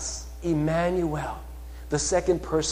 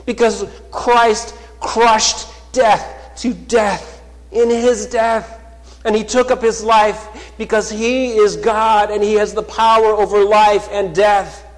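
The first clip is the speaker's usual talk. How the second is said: loud, high, rising sermon voice, slowing on key words; fervent pulpit proclamation; full-force preaching; 5 semitones higher, 11 dB louder than usual. Acted. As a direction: preach it out to the whole church. Preaching climax on the cross.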